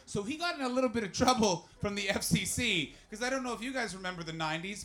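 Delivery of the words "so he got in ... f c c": normal talking voice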